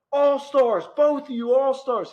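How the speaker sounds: high, loud cheering shout; fan yell. Retold or imitated. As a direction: yell it across the field. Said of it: "We're like".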